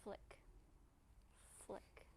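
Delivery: soft, soothing relaxation voice; quiet, gentle cues with long pauses; guided meditation. Real, not acted. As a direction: ease them into letting tension go.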